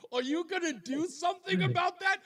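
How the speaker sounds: high-pitched amused disbelief; mock incredulous. Host replaying girlfriend's question to caller, mock incredulous. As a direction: push it way up high, incredulous and nearly laughing.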